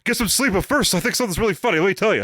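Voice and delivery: high, fast mock captain; lightly voiced police captain; Cragen impression. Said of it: "It's like".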